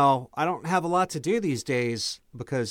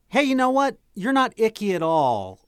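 high, loud fake-friendly flattery; butter her up brightly; dwarf sweet-talking the giant spider, fake friendly; flattering; 9 semitones higher, 5 dB louder than usual.

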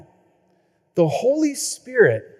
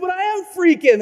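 mock panic; 11 semitones higher, 3 dB louder than usual. Panicked caller.

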